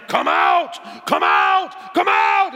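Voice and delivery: loud, high-pitched screamed command; imitated screaming pastor. Zambian pastor to the boy on the ground, screamed command. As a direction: scream it like a frantic exorcist. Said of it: "He's screaming at him".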